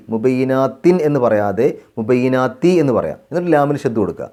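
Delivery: ordinary delivery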